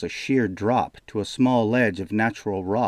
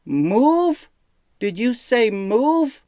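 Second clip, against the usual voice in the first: high, loud, slow demand; shouting question; 14 semitones higher, 5 dB louder, much slower than usual. A narrator's reading. Jaden shouts back at the distant demon. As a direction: yell the question up.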